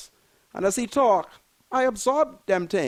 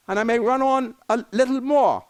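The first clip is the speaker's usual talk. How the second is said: normal talking voice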